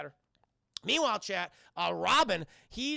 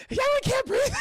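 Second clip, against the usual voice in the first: high, loud mock gasp for air; hammed-up breathless yelp; mock panic; 11 dB louder than usual. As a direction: squeal it as if out of air. Retold winded player in huddle.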